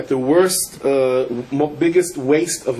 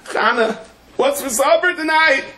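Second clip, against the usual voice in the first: called higher and louder across the room; 12 semitones higher than usual. Retold or imitated. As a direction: raise your voice to reach her. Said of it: "He says it louder".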